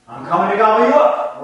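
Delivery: loud, high, fast troll roar. Acted. Preacher roaring the troll's threat at the goat. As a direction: bellow it like a hungry troll. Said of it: "roared the troll".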